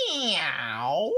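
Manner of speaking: a low lazy meow; cat meow. A narrator's reading. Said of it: "said Cat".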